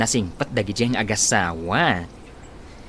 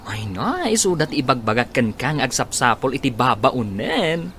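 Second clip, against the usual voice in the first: ordinary talk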